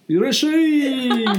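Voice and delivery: high, loud, slow shop greeting; izakaya welcome shout. Retold or imitated. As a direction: bellow a welcome like bar staff. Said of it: "みたいな".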